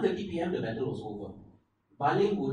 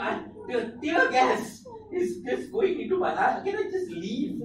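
mock whiny child; 4 dB louder than usual. Voicing a child in tear gas.

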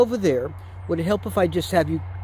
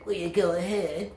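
softer parroting echo; mockingbird mimicry; 3 semitones lower, 5 dB quieter than usual. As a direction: mimic the question back like a bird. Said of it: "I'm a mockingbird".

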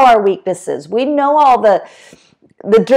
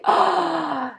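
soft, high gasped "oh"; hushed mock-swoon intake; admiring gasp; 6 semitones higher, 7 dB quieter than usual. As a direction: imitate a gasp of awe. Neighbour's admiring gasp, retold.

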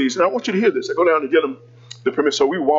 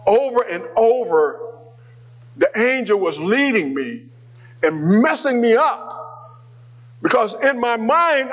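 preaching; 5 semitones higher than usual. Acted. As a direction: lift the congregation line by line. Preaching builds up.

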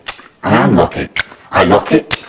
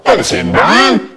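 cheery dad; 8 semitones higher than usual. A narrator's reading. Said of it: "says my dad cheerily".

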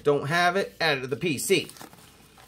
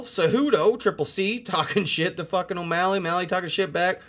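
ordinary talk

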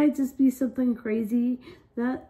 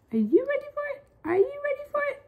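high squeaky hype; much faster than usual. Acted. Hyping up the challenge.

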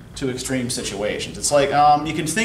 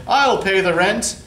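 heroic rescuer; 6 semitones higher, 3 dB louder than usual. Acted. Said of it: "the hero steps in with the money".

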